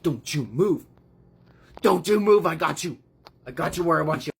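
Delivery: hammed-up triumphant menace; mock threatening. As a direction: act a villain holding someone at gunpoint. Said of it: "Booth is like".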